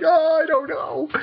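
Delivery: squeaky high, whiny panic; panicky gold leader. Retold or imitated. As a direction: play him as a flustered coward caught out. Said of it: "He's like".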